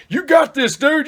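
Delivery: high, loud pumped-up spotter; mock spotter hype. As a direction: cheer him on like an overeager gym bro. Spotter hyping him on bench.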